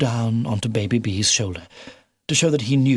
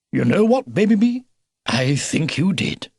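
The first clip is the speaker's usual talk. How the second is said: put-on old beaver, indulgent; 5 semitones higher, 3 dB louder than usual. A narrator's reading. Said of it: "said the old beaver".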